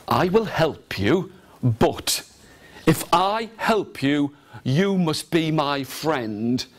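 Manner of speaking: slow, deliberate beggar voice. Acted. Beggar offers help for friendship, slow and measured. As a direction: spell out the bargain slowly. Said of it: "the beggar looked and said".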